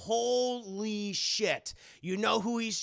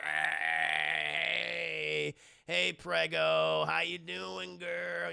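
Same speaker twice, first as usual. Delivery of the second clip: put-on slow, drawn-out drunk greeting; bad fake drunk; 3 semitones lower, much slower than usual. Drunk husband greeting pregnant wife, badly faked slur. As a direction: play badly faked drunk, stretching the words. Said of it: "he pretends to be drunk".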